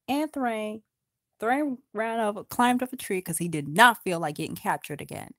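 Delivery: plain reading voice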